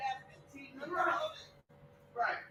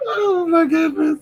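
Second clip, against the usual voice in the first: excited gasp; 11 semitones higher, 8 dB louder, slower than usual. Real, not acted. Sudden excited outburst on stream.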